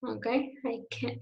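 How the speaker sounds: ordinary delivery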